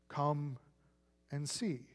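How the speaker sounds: Jesus inviting them into his life, tenderly; tender invitation. Retold or imitated. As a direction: let "and see" fall to a near hush. Invitation repeated softly.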